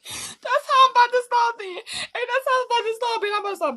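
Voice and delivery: high, loud, laughing through the words; cracking up as she says it; crying with laughter; laughing hard. Real, not acted. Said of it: "laughing, overcome".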